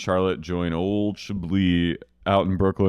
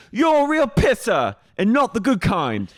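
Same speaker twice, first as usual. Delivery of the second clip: put-on Cockney accent; 12 semitones higher than usual. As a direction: sneer it in a hammed-up Cockney accent. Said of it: "inexplicably has a Cockney accent".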